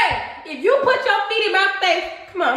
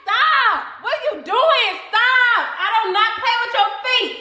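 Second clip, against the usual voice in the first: yelling stop; 6 semitones higher than usual. Real, not acted. Yelling at partner, laughing.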